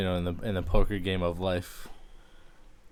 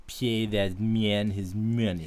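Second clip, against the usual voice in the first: slow, drawn-out accented villain impression; much slower than usual. Friend imitates poker villain conceding the hand, slow accented impression. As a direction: draw out each word in his odd accent. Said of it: "that was a good impression".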